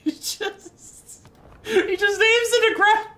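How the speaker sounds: very high squeak, close to losing it; helpless squealing. Real, not acted. Streamer describing the tank's aim, helpless squealing. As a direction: squeeze the words out through helpless hilarity.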